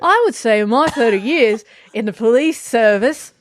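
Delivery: put-on full Australian accent on the police quote. Told to go with it, reading in Australian accent. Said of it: "This is the best accent I've ever done in Australian".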